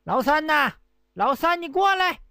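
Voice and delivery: high, hammed-up hollering to a waiter; loud call. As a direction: call the waiter across the room at full pitch. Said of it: "对着这酒楼的跑堂的大喊一声".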